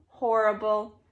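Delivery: plain reading voice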